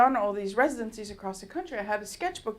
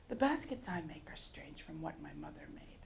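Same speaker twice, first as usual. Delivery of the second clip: a soft near-whisper; soft hushed; 6 semitones lower, 13 dB quieter than usual. Retold or imitated. Great-grandmother's quiet permission, softly hushed. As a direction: hush it gently.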